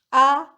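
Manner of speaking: "ah" held level and loud; first tone. Shown as a demonstration. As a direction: say "ah" loud on an even, unbending pitch. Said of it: "The first tone".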